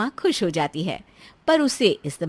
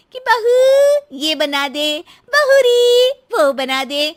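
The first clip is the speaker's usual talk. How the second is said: high, loud, drawn-out calls; mocking mother-in-law; 12 semitones higher, 11 dB louder, slower than usual. Wife imitating mother-in-law's endless orders, mocking and loud. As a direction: mimic her nagging summons, stretching the words.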